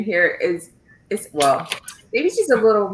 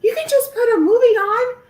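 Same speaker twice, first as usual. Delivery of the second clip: put-on much higher, louder pleading kid voice; whiny kids; 5 dB louder than usual. Teacher mimicking her students asking for a movie, in a high pleading voice. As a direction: beg like a student who wants a movie. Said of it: "My kids are like".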